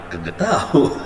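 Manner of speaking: put-on a confused kid's answer, laughing through it. The confused child laughs as he answers his friends. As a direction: shrug it off with a laugh.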